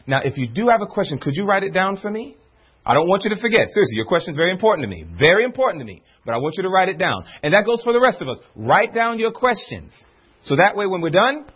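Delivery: normal talking voice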